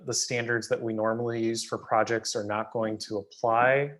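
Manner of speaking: ordinary delivery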